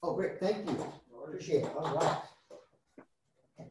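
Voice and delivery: soft, away from the microphone; off-mic aside. Real, not acted. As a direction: thank them quietly off mic. Thanking the person at the door.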